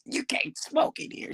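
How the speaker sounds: a whispered, slowed mock of the old man. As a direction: hiss the rule back at him in a mocking whisper. Mocking old man, whispered repeat.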